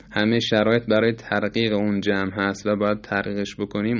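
ordinary talk, nothing marked

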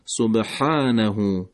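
سبحانه very slowly with a tight throat ha; Arabic ha with throat tightness; throaty ha. Shown as a demonstration. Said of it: "از حلق با مقداری گرفتگی".

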